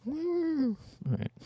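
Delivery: high soft creature voice; Kelpie impression. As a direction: sigh it high like a beaten kelpie. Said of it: "my Kelpie impression".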